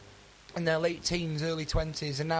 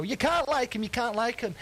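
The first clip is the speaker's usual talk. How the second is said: mock yell; 6 semitones higher than usual. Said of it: "they'll be screaming at the radio".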